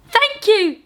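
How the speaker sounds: put-on baby mice thanking the giant in squeaks; squeaking thanks. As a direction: pipe it up like tiny mice. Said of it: "they squeaked".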